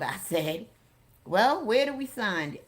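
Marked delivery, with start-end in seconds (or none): 1.2-2.6 louder, higher jokey question; keen, joking readiness. A narrator's reading. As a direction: toss it out as a joke. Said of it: "Eddie joked".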